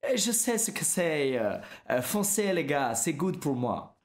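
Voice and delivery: slow French in a hammed-up American accent. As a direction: exaggerate an American accent, dragging the words. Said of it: "un accent américain quand je dis ça".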